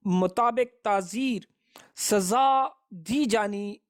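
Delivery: ordinary talk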